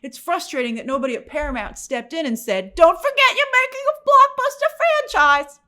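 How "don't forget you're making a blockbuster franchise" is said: high, loud insistent reminder; mock studio exec. Paramount exec warning the director, high loud insistence. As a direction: bark it like a studio boss.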